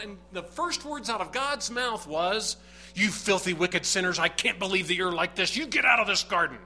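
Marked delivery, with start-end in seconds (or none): 2.9-6.6 loud condemning rant, speeding up; mock angry God. Preacher mocking a condemning God, loud rant. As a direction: hammer them as a harsh judge. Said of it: "Is that what God said? No".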